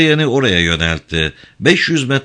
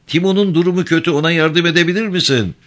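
high, hurried worried appeal; calling out; 8 semitones higher, faster than usual. A narrator's reading. Driver asks Craig to help the wounded man, calling out. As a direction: call for help quickly, pitched high. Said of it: "diye seslendi Selyen".

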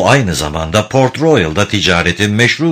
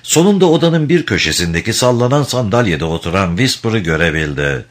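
normal talking voice

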